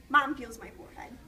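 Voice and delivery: plain reading voice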